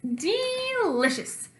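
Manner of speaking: high, slow, relishing drawl; savouring. Acted. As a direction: stretch it out with pleasure. Monster savouring poison soup.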